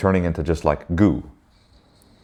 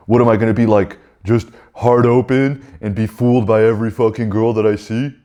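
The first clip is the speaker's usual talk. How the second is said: higher, louder inner ego objecting; indignant ego; 4 semitones higher, 3 dB louder than usual. Acted. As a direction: protest as the scornful ego. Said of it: "You know what your ego says?".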